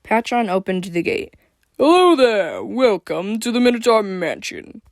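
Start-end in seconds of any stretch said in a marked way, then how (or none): 1.8-4.8 loud, high, booming welcome; big swooping gatekeeper's hail; snorting minotaur host. A narrator's reading. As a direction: belt out a showy greeting. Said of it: "he snorted".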